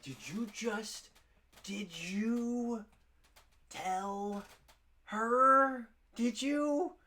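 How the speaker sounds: very high, loud, drawn-out squeal; mock panicked accusation. Employee eyeballing coworker who may have told, squealed mock accusation.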